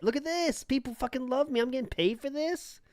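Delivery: high, softened mock glee; giddy self-delight. He mimics the YouTuber getting paid by fans, giddy delight. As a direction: imitate someone marvelling at his luck in a high, small voice.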